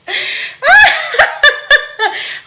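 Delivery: high, loud helpless laugh; burst of laughter. Real, not acted. Host cracking up at the story, high and loud. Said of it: "Amiga, eu tô rindo".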